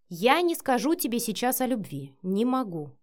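ordinary delivery

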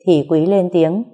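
usual speaking voice

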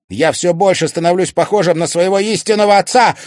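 loud, high confession; heated outburst. A narrator's reading. Son telling mother he resembles his father, heated outburst. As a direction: blurt it out at full voice.

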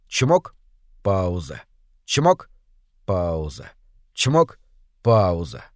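чмок up, пауза dropped low; rhythmic pump. A narrator's reading. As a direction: mechanical beat: чмок rising, пауза falling deep. Помпа. (ритмично: «чмок» вверх, «пауза» низко вниз)

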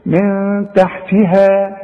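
very slow من تحتها with a thin, light هاء. Shown as a demonstration. Teacher showing the student a light letter, slow. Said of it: "شوف".

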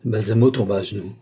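ordinary delivery

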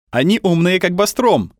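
high, loud excited cry; exclaiming boy. A narrator's reading. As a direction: exclaim it like a thrilled kid. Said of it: "воскликнул мальчик".

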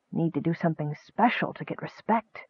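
very soft, hushed dream voice; soothing. A narrator's reading. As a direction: coax gently, barely above a whisper. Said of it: "The voice soothed him".

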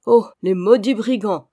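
loud, cursing exclamation; indignant chorus cry. A narrator's reading. Servants joining to curse the brigands, indignant outcry. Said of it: "reprit le chœur".

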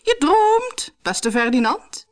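very high queenly voice, easing down at the end; high-pitched storybook queen. A narrator's reading. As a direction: put on a high voice to set the king straight. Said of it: "zei de koningin".

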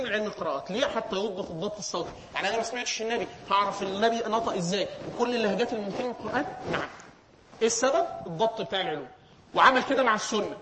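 ordinary talk

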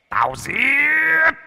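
shrieking ghost voice. Acted. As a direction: screech the last threat. Ghost's final threat.

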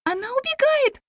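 very high urgent exclamation; alarmed cry. A narrator's reading. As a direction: cry out that she is escaping. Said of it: "воскликнула я".

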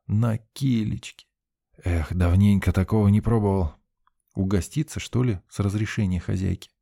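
usual speaking voice

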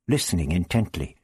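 plain reading voice

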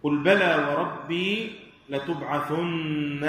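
measured recitation, holding the doubled nun of لتبعثن; heavy doubled nun. Shown as a demonstration. Teacher reciting a verse to show the heavy nun, doubled and held. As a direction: recite slowly, leaning long on the نّ ending لتبعثن. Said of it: "لاحظوا الثقل فيها".

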